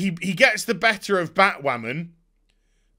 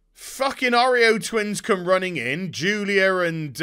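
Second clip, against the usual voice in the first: normal talking voice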